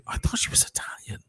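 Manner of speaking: a surprised whisper. Retold or imitated. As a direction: whisper it as if amazed. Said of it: "they'd be like".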